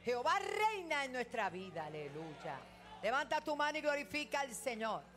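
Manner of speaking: loud, high exhortation; fervent pulpit call; preaching at full force. Real, not acted. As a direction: lift the room to praise.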